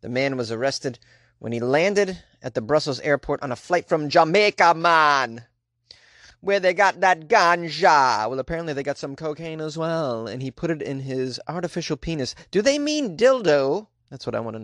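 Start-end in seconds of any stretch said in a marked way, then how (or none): 3.5-8.3 put-on Jamaican accent.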